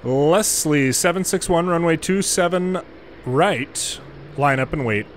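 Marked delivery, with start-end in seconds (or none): none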